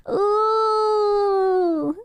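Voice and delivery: long high "ooh"; put-on high coo, held out; teasing drawn-out squeal; mock swooning ooh. Mock swooning at being yelled at.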